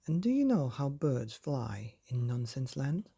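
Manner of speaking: usual speaking voice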